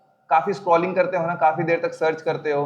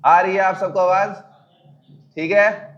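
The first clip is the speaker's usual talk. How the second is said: raised, higher voice to reach the back; louder, higher check that everyone hears; speaking loudly; 4 semitones higher, 5 dB louder than usual. Real, not acted. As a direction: project louder to the whole room. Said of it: "अब मैं तेज-तेज बोलता हूँ".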